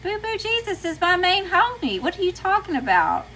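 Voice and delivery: high-pitched mock-incredulous comeback; sassy retort. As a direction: act out an indignant, sassy reply. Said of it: "I'm like".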